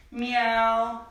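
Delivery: playful mock meow for the kids; cat meow. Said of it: "can you be a cat".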